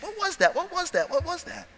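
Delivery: high, softening repeated question; puzzled recollection. Retold or imitated. Trainee recalling talk of a test, high puzzled wondering. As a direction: grope for the memory in a high voice.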